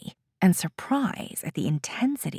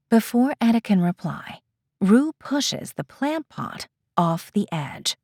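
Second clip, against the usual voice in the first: ordinary delivery, nothing marked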